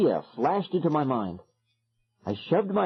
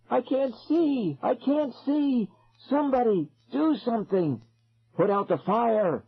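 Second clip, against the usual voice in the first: put-on blinded kid crying out; 11 semitones higher than usual. A narrator's reading. Blinded kids screaming.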